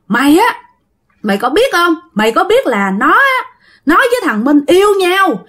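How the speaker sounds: high, indignant acted scolding; father cursing. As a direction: lay into the mother like an irate husband. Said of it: "ba mới chửi".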